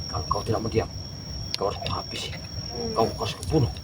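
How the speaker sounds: soft, low whispered threat. Acted. Jakabaya whispers a death threat to the captive. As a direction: threaten under your breath.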